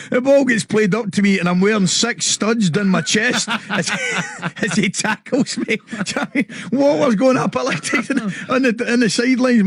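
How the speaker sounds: high-pitched, through laughter; laughing while talking. Real, not acted. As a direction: tell it cracking up, pitch climbing. Cracking up telling his tackle story.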